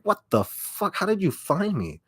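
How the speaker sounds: high, stunned disbelief; freaked out. Retold or imitated. Vlogger reliving fans finding her, pitched high in shock. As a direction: react like you've just been found out. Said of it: "I'm like".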